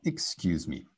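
ordinary delivery

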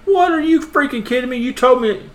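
high-pitched indignant protest; mock outrage. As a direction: imitate a man squealing in angry disbelief. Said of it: "the guy started to get mad".

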